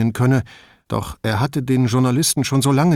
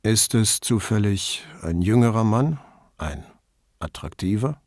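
icy cool. Acted. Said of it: "Seine Stimme klang unterkühlt".

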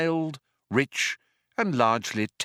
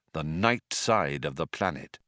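ordinary delivery

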